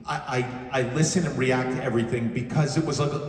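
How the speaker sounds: normal talking voice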